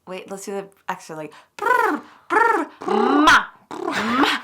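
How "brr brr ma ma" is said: high, loud lip trills; vocal warm-up trills. Pretend. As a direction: warm up the voice with brr sounds.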